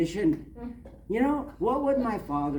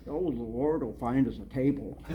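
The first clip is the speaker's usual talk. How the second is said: mocking mutter; 5 semitones lower than usual. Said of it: "Now I'm really mad".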